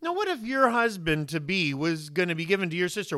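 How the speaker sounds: ordinary talk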